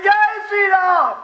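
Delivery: loud, high, drawn-out chant call; rallying devotional call. Real, not acted. Preacher asks the crowd to take the name, rallying call. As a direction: call it out slowly for everyone to repeat.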